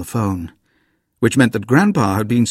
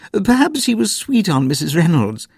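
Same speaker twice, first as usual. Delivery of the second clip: with a twinkle; 10 semitones higher, 3 dB louder than usual. A narrator's reading. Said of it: "said Granny with a twinkle".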